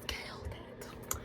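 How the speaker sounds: fast secret whisper; hushed, breathy aside. Real, not acted. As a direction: lean in and whisper it like a confidence. Whispered aside about favorite role.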